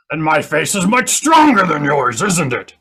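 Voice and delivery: loud acted comeback, easing slightly at the end; mock aggressive retort. Comic retorts to a lady on the elevator, loud mock indignation. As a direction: punch the retort out loudly.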